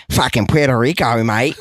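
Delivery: put-on lightly done Australian accent on "Fucking Puerto Rico, mate". Imitating an Australian saying Puerto Rico, mock Australian accent. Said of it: "you say".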